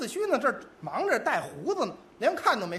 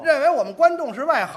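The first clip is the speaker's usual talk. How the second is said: loud, raised-pitch mock indignation; indignant spectator; 3 semitones higher, 5 dB louder than usual. Audience member resents being taken for ignorant, loud indignation. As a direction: protest like an affronted opera fan.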